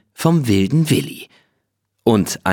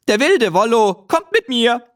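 put-on much higher, exultant boyish cry; triumphant call; 13 semitones higher than usual. A narrator's reading. Willi claiming the horse before the sheikh with a much higher exultant cry. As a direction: announce it like a victorious little hero. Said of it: "rief der wilde Willi".

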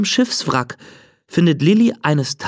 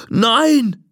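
a pleading shout; ghost shouting; 6 semitones higher than usual. Acted. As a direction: cry out to stop her leaving. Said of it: "schreit der Geist".

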